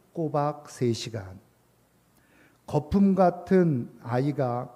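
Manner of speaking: slow, measured reading with long pauses; poem recitation. A narrator's reading. Preacher reads a poem about a crying child to the congregation, slow recitation. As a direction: read the verse aloud, letting each line settle.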